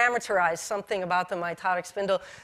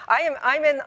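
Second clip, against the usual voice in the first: ordinary talk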